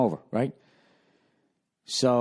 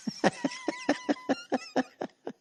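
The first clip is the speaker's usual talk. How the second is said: high wheezy giggle; helpless squeaky chuckle; wheezing laugh; 6 dB quieter than usual. Real, not acted. Laughs at being called mister.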